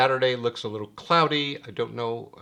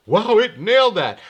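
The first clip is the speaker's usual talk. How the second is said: high, loud imitation of amazed fans; mock-amazed quote, pitched up; impressed reaction; 9 semitones higher, 9 dB louder than usual. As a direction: gush loudly like an impressed viewer. Voicing impressed viewers' feedback.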